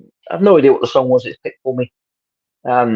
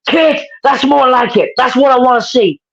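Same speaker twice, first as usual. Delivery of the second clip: a much higher, slow and pleased attempt at an American accent; smiling American accent; 11 semitones higher than usual. Praising the kid after a clean break, smiling American accent. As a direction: praise him in a grinning American drawl. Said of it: "with a big smile".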